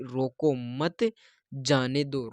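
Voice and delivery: softly, slowly रोको मत, pause, then जाने दो; quiet misheard version, broken after मत. Shown as a demonstration. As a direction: draw out रोको मत, then let जाने दो fall away. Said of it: "कुछ और ही सुन लिया कि".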